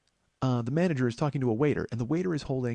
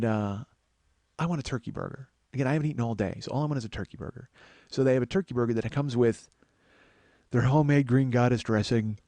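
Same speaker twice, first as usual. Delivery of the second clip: normal talking voice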